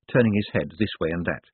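ordinary delivery

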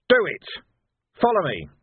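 loud, short orders; sharp command shout. A narrator's reading. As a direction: lead the charge. Vimes commands Sam.

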